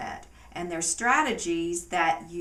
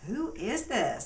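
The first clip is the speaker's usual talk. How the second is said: higher-pitched baffled question; mock bewilderment; 4 semitones higher than usual. Disillusioned partner looking at their spouse, mock bewilderment. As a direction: puzzle over a partner as if a stranger.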